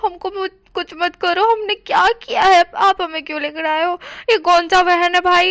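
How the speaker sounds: panicked hammed-up begging to be let go; shouting plea. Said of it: "वो चैन चिल्ला चिल्ला के बोल रहा था". (चिल्लाकर छोड़ देने की भीख)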